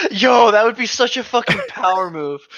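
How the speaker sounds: high, loud and laughing with delight; cracking up at the idea; excited laughing. Real, not acted. Laughing at bringing a dog.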